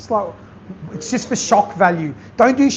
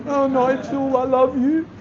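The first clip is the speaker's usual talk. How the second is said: put-on high, sing-song drunk affection; tipsy gushing; 8 semitones higher than usual. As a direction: gush like two bottles of wine in. Drunk gushing quote after Chardonnay.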